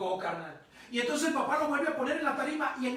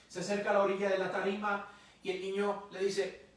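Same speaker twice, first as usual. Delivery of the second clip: ordinary delivery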